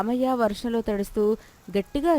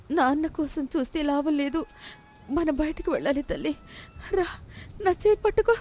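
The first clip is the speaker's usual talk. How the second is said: higher, urgent but steady mother; determined mother; 6 semitones higher than usual. Acted. Lakshmi telling Chinni to hold her hand, urgent. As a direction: gather courage to get out.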